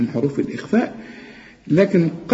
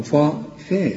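ordinary talk, nothing marked

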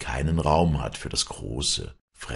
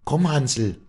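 high, louder beckoning call; coaxing a bird; 12 semitones higher, 7 dB louder than usual. A narrator's reading. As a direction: lure your pet bird over. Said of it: "lockte sie den Vogel".

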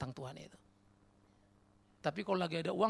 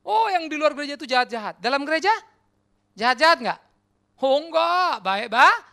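mock self-righteous; 8 semitones higher, 13 dB louder than usual. Mocking churchgoers' self-righteous claim.

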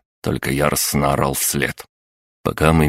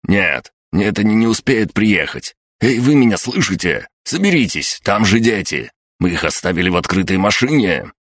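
urgent pleading; 5 semitones higher than usual. A narrator's reading. Sergeant urging about children.

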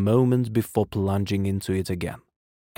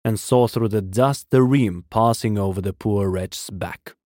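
usual speaking voice

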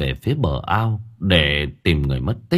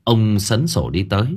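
plain reading voice

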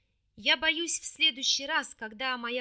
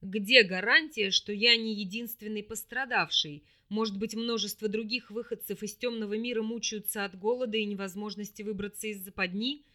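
threatening; 2 semitones lower, 4 dB louder than usual. A narrator's reading. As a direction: let the threat sound clearly. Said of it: "явственно прозвучала угроза".

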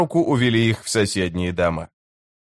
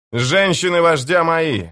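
higher-pitched announcement, only mildly raised voice; proclamation; 7 semitones higher than usual. Acted. Karsa claiming the women before his men, proclaiming. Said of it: "объявил Карса".

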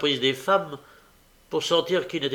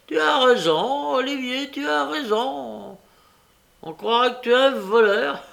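gloating villain; 11 semitones higher, 5 dB louder than usual. A narrator's reading.

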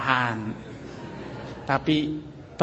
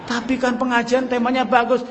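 pleading wife; 7 semitones higher than usual. Acted.

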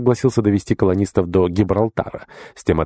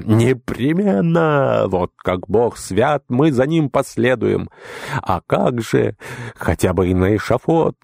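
raised, slow rapturous cries; rapturous cheering; 5 semitones higher, slower than usual. Acted. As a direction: cheer with delight. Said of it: "огласили резиденцию криками восторга".

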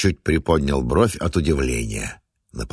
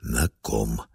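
hushed, lower question; quiet; 7 semitones lower, 6 dB quieter than usual. Acted. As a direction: ask it softly, dreading the answer. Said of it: "вопрос прозвучал тихо".

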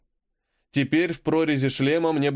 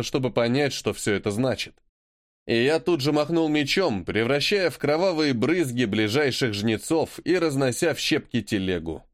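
usual speaking voice

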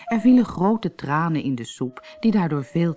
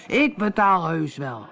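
lightly voiced pompous captain, slow; a blustering captain calling out in the inn; a hint of a pompous captain; boastful captain; 4 semitones higher, slower than usual. Captain's voice held.

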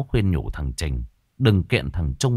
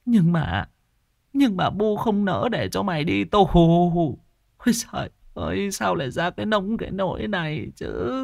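whimpering; 10 semitones higher than usual. A narrator's reading. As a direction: plead with a crying mother's voice. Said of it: "Bà Mơ mếu máo". (bà mẹ mếu máo van con)

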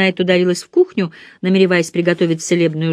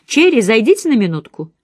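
clear, crisp call; 6 semitones higher, 5 dB louder than usual. A narrator's reading. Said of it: "ясный и чёткий голос мисс Марпл".

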